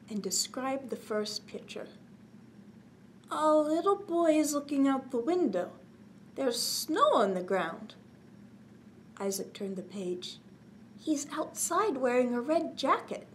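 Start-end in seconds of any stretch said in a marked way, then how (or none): 3.3-8.0 put-on higher, eager little boy voice; little Isaac describing the pictures to Grandpop; young boy reading. Child reads picture book. 11.0-13.3 put-on higher, eager little boy voice; little Isaac describing the pictures to Grandpop; young boy reading. Child reads picture book.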